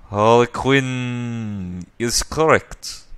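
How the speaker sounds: put-on slow German-accented figure. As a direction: relish each word in a slow German accent.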